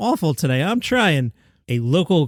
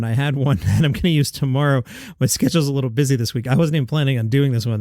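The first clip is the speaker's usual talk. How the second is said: ordinary talk, nothing marked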